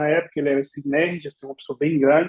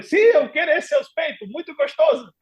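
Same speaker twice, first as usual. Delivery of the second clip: eager insisting; 10 semitones higher than usual. Retold or imitated. Eager naive foreigner.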